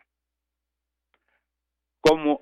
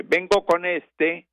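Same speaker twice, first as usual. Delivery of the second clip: higher, slow farmer's line; slow, raised farmer's voice; voicing the farmer; 7 semitones higher, 4 dB louder, slower than usual. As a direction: speak the farmer's words slowly and up.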